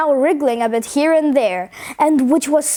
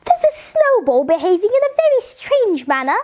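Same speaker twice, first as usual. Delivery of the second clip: put-on higher, fussy old lady voice; old lady alarmed, telling a policeman, high; 7 semitones higher than usual. A narrator's reading. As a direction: report it like a flustered old lady. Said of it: "she told him".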